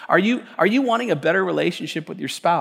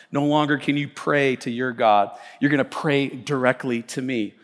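ordinary delivery

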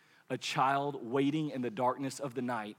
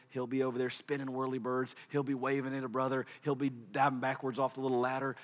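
usual speaking voice

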